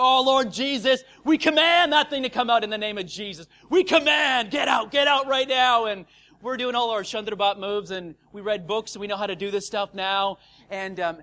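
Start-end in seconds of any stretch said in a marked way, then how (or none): 0.0-5.8 loud, commanding deliverance prayer; mock spiritual warfare. Bystanders commanding the thing out in Jesus' name, mock-fervent. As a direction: parody a fervent exorcism, shouting the commands. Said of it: "we're doing spiritual warfare".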